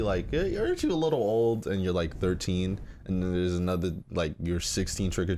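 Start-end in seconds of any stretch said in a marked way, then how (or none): 0.3-1.7 teasing chide, pitched up high; a mock-sweet poke at a teen's age; playful coy scolding, higher. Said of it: "There's one thing saying it coyly".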